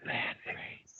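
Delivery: hushed, voiceless name; whisper. Shown as a demonstration. Coach shows mother how boy says name, whispering. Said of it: "I just had him whisper his name".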